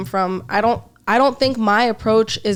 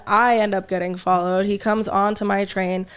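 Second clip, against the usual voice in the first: ordinary talk, nothing marked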